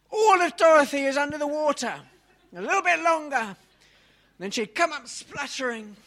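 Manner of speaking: rising high and loud as he plunges her under; hammed-up baptism, climbing to a near shout; dramatic dunking. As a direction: act out holding her down, building to a shout. Mock baptism continued.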